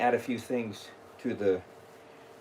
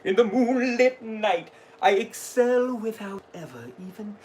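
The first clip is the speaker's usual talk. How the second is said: dramatic, slow spooky showman; a theatrical ghoulish gentleman; Jack Skellington voice; 5 semitones higher than usual. Acted. Recites Jack's lines in character.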